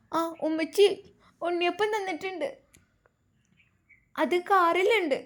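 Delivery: put-on small child. A narrator's reading. Little boy telling grandmother about the sweets.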